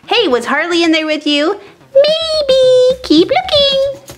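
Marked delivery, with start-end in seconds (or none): 1.9-4.2 lightly voiced playful doll; high toy voice. A toy answers the host, in a high voice. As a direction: tease the searcher, higher.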